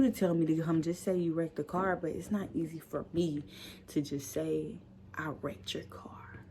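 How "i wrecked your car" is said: breathy whispered confession. Retold or imitated. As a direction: barely dare to say it. Too scared to confess.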